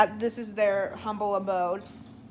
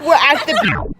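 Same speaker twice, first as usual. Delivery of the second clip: bright, louder and higher presenter voice, a touch hammed-up; mock vlogger; 5 semitones higher, 10 dB louder than usual. Telling the vlog about the day, mock presenter voice. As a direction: play a cheesy travel vlogger. Friend says tell your vlog.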